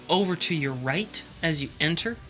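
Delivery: ordinary talk, nothing marked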